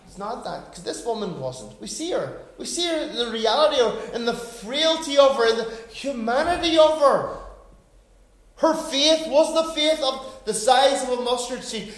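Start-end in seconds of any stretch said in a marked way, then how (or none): 3.1-11.9 impassioned preaching. Real, not acted. Preaching at full force.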